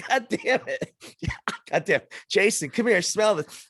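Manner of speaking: hammed-up dad between laughs; laughing through impression. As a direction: giggle while doing the dad. Dad retold while laughing.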